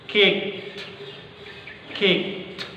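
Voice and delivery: "Kicked" with the final t barely heard; kicked, t dropped. Shown as a demonstration. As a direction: clip the t off "Kicked". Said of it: "Seperti itu". ("Kicked" dengan T di-drop)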